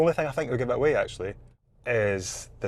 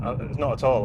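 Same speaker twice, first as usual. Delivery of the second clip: ordinary talk, nothing marked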